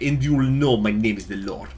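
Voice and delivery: put-on Russian accent at everyday volume. Streamer redoes the quote for the Republic, Russian accent. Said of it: "in a Russian accent".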